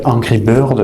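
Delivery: Angry Bird in a plain French accent; English words with a French r; own French accent. Shown as a demonstration. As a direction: say it the French way, no American put-on. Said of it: "avec mon accent à moi".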